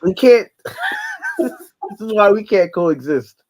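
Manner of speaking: loud laughing, drawn out. Real, not acted. Joking they can't coexist, laughing loudly. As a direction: laugh the words out slowly.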